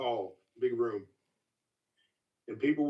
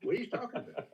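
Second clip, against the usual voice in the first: rising, puzzled what; mock bafflement at a stern look; puzzled inner what; 10 semitones higher, 5 dB quieter, faster than usual. Telling the hosts his own puzzled reaction to Jimmy. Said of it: "I'm thinking".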